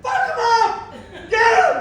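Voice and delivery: high, loud rowdy cheer; mock cheering crowd. Monkeys cheering on the alpha monkey, rowdy shouting. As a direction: cheer like a bloodthirsty mob. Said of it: "all the monkeys are cheering".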